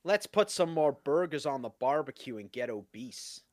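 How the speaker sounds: put-on American dude voice; American accent. Shown as a demonstration. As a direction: fake a stock American accent. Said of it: "do the American accent like you've".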